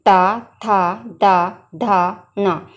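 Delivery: ता, था, दा, धा, ना drawn out slowly, tongue touching the teeth; slow dental drill; syllable demo. Shown as a demonstration. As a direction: say ता, था, दा, धा, ना very slowly for pupils to copy. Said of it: "देखिए".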